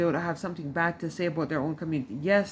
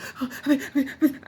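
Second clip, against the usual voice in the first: a quick, startled mock gasp; imitated sharp gasp; 7 semitones higher than usual. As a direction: gasp like a jittery friend. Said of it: "everything was like".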